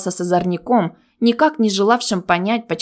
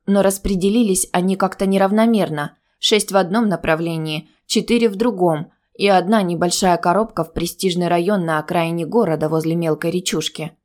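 ordinary delivery

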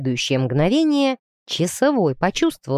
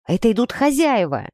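mildly raised, higher warning cry; shouted warning; 4 semitones higher than usual. A narrator's reading. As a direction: call out a warning, not at full voice. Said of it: "крикнул вдруг кто-то". (предупреждающий окрик)

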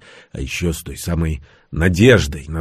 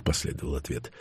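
ordinary delivery

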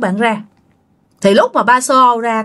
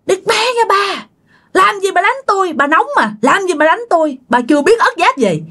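high-pitched cross protest; angry scolding, acted; 8 semitones higher than usual. Dad cursing back after being hit, acted anger. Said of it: "Ba cũng chửi nha".

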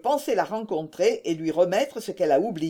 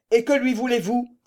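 higher, irritated challenge; undisguised anger; 5 semitones higher, 4 dB louder than usual. A narrator's reading. Germain answering the prying farmer, undisguised anger. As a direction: challenge him with open annoyance. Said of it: "sans chercher à déguiser sa colère".

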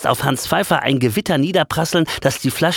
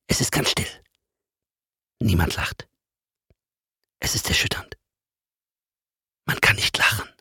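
hushed narration; 3 semitones lower than usual. A narrator's reading. Narrator hushes the listeners as both stand frozen, near-whispered. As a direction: drop to a hush for the frozen silence.

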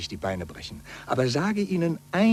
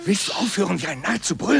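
exasperated scolding; 7 semitones higher, faster than usual. Acted. Friend scolds the shouting.

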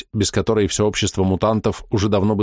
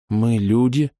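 timid; 3 semitones higher, much slower than usual. Acted. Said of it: "боязливо подумала женщина".

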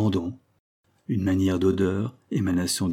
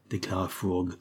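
ordinary delivery, nothing marked

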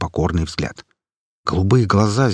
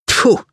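disgusted spit; 5 dB louder than usual. Acted. Granny spits in disgust.

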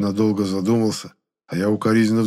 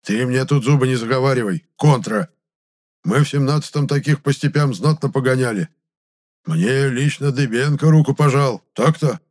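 put-on indignant old man, higher and louder; raised, scolding old veteran's voice; suddenly angry; 5 semitones higher, 5 dB louder than usual. A narrator's reading. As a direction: flare up and boast crossly. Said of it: "дед и внезапно рассердился".

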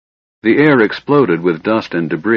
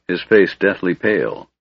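plain reading voice

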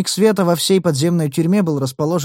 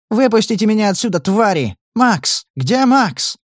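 screaming; 8 semitones higher than usual. Acted. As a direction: scream for Max from the cell. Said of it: "вопль Наты".

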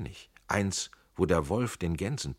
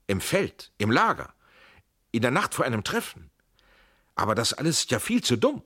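louder, higher, indignant outburst; outraged shout; 5 semitones higher, 7 dB louder than usual. Acted. Tränk cries out in outrage at the slander. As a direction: cut in, incensed at the absurd lie. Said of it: "schrie Tränk aufgebracht".

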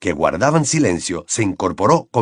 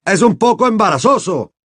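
grave; 9 semitones higher, 3 dB louder than usual. Acted. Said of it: "declaró con gravedad".

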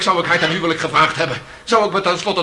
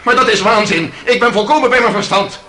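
higher, louder protest; indignant protest; 6 semitones higher, 4 dB louder than usual. Acted. Accused protesting his sanity to the president. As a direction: insist hotly.

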